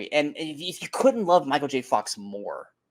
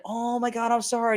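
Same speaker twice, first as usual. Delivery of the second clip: high-pitched mock apology from the fan; flustered fan; 8 semitones higher than usual. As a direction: imitate her startled sorry, pitched up. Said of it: "And she's like".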